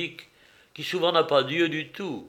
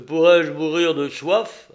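a louder, abrupt interruption; interrupting question; 2 semitones higher, 7 dB louder than usual. Acted. Hungry man interrupts his companion about thirst, interrupting question. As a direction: cut in with an anxious question.

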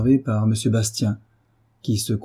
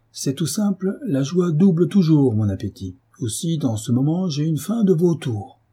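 higher, mocking gleeful taunt; gloating sneer; 7 semitones higher than usual. A narrator's reading. Jacques gloats to his friend, higher and mocking. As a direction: gloat with false cheer.

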